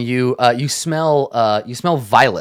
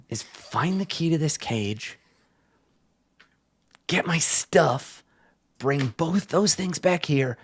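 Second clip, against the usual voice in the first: hushed urging; 3 semitones higher, 5 dB quieter than usual. Acted. Caged man urging quietly.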